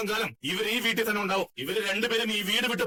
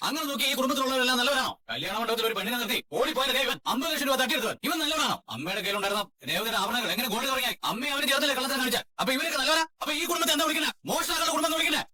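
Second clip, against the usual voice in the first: rising, higher furious retort; heated accusation; 4 semitones higher than usual. Acted. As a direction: throw it back at her, mounting anger. Son hurling accusations back in quarrel. (ചൂടേറിയ ദേഷ്യത്തോടെ)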